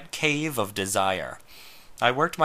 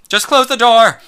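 very loud high cry, Close punched; loud impassioned demand, "close" hit hard; passionate shout; 12 semitones higher, 13 dB louder than usual. Acted. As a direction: yell it, full of passion. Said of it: "his voice was so full of passion".

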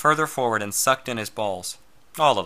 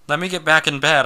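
pressing, raised voice; raised urgent plea; urging; 5 semitones higher, 6 dB louder than usual. Acted. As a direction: urge him, a bit louder. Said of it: "I urged".

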